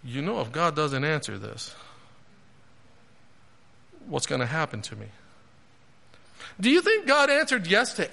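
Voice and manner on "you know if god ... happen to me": low, soft, wondering to himself; private doubt. Acted. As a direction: murmur an imagined worry under the breath. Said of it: "any part of him that was like".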